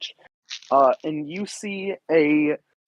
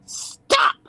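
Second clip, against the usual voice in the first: lightly voiced tiny pixie. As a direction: squeak it like a tiny fae. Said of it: "you hear a tiny voice".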